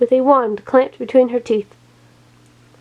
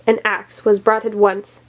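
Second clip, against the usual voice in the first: ordinary delivery, nothing marked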